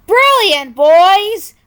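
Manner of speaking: high, loud cry of delight. A narrator's reading. Birds crying out in delight at the trap. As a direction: cheer the boys on. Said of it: "cried out in delight".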